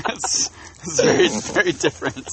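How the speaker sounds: words squeezed out through a laugh; laughing protest. Real, not acted.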